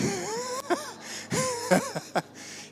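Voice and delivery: a high burst of laughter at his own joke. Real, not acted. (risada aguda do pregador com a própria piada)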